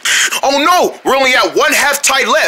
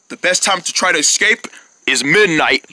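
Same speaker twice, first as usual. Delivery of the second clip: usual speaking voice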